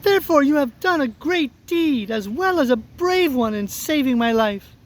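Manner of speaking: high, tiny regal voice; squeaky mouse queen. Acted. Mouse queen praising the woodman, high squeaky voice. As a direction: pronounce it as a little mouse queen. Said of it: "added the queen".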